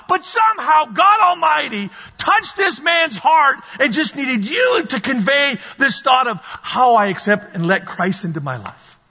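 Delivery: ordinary talk, nothing marked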